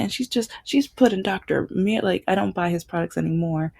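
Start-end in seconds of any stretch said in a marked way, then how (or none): none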